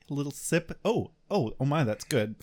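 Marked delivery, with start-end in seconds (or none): none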